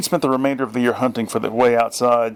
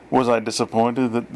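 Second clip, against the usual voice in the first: ordinary delivery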